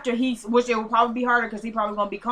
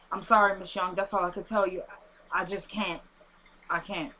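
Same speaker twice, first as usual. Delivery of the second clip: acted soft, low apology; reluctant refusal; 5 semitones lower, 7 dB quieter than usual. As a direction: refuse quietly, sinking lower and softer. Voicing caller who refuses to tell.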